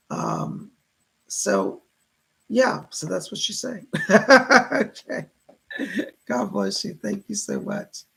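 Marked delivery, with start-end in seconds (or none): 3.9-4.8 hearty burst of laughter. Real, not acted. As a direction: laugh out loud, higher and louder. Amused after relaying the message.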